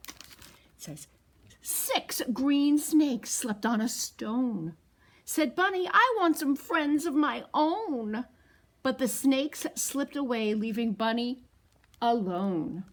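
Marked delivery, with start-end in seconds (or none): 1.6-12.8 louder, slow sing-song read-aloud; picture book read to toddlers; storytime reading. A narrator's reading. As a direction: read it slowly and big for the kids.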